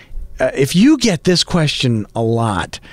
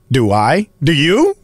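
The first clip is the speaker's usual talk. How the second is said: normal talking voice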